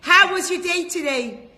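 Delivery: mother greeting her son off the bus, overjoyed. Acted. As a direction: run to the bus and coo over him. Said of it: "She goes".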